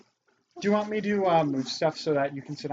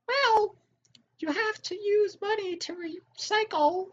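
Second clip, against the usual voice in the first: very high mocking squeak; squeaky sarcastic mimicry. Mocking the recycling people.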